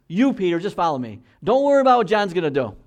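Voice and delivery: emphatic command. Retold or imitated. Paraphrasing Jesus' emphatic command.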